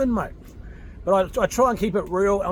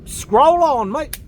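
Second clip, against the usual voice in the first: scroll on flung out much higher and louder; loud dismissal; 7 semitones higher, 6 dB louder, much slower than usual. Real, not acted. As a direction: bark it at the haters. Telling critics to move on.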